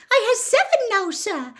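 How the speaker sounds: high, loud squeaky elf joy; excited house-elf. Acted. Dobby voice.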